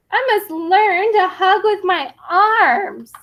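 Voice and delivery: mock crying plea. Tiny begs his mother, mock tearful. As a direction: plead as if near tears.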